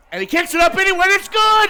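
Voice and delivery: high, loud shout as the kick goes up; excited call. Real, not acted. Extra point call live.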